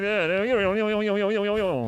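fast rattling auctioneer patter. Shown as a demonstration. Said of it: "maybe I should get my auctioneer voice out".

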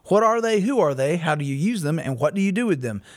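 normal talking voice

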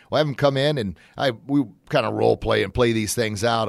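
ordinary talk, nothing marked

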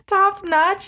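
high, teasing repeat; mocking echo. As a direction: echo it back with a grin. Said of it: "he said".

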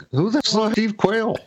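high, disbelieving question; incredulous. Retold or imitated. Learning the stranger's name from his wife, high incredulous question. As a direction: blurt out who on earth that is.

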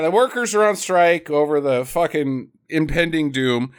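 ordinary talk, nothing marked

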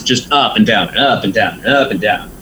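usual speaking voice

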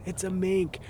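high, slow and delighted; excited. Real, not acted. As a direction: savour the sight of the mink. Spotting a mink at night.

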